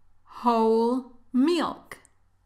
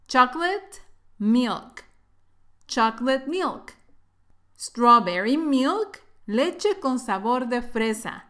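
ordinary delivery

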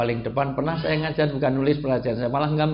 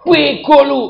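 very high, loud jeering shout; acted outburst of rage; angry yelling; 13 semitones higher, 13 dB louder, slower than usual. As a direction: bellow the insult as if furious. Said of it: "Deket tapi teriak".

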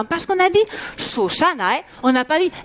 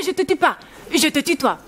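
usual speaking voice

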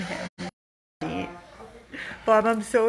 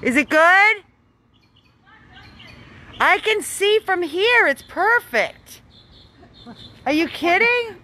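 high, raised voice across the yard; calling out; 11 semitones higher, 5 dB louder than usual. Real, not acted. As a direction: project it to someone far off.

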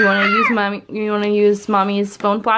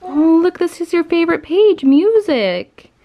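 excited; 8 semitones higher, 5 dB louder than usual. Real, not acted. Excited mom showing book.

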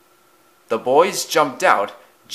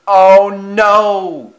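long, high, very loud wail; terrified cry; 8 semitones higher, 11 dB louder than usual. A narrator's reading. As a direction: brace for the crash and cry out. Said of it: "he cried as he shut his eyes".

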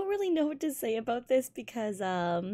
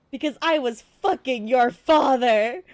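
loud triumphant confession; shouted reveal; 10 dB louder than usual. Acted. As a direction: throw the reveal at him. Shock reveal in skit.

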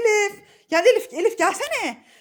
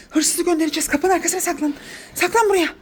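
ordinary delivery

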